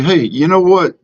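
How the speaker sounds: usual speaking voice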